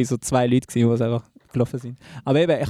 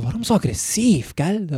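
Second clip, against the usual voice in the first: higher, drawn-out mock protest; retold protest; 6 semitones higher, slower than usual. Storyteller replaying their reply to the aggressive stranger, protesting. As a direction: question the aggression in a raised, slowed voice. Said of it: "Und ich so".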